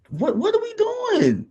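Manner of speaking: high, cracking what are we doing; exasperated disbelief. Real, not acted. Fan mocking rival fans' hero worship, high exasperated disbelief. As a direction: leap up high in mock bewilderment.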